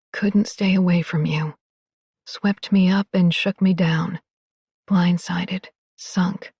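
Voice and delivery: hushed, tender reading of lyrics. Acted. Reading written love lyrics softly.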